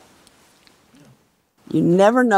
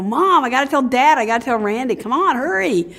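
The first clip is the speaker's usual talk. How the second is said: higher-pitched, urgent pestering of Mom; excited kid; 6 semitones higher than usual. Retold or imitated. Eleven-year-old bouncing on the car seat to Mom, excited. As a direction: reenact an eager child rushing a parent. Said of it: "excited I was".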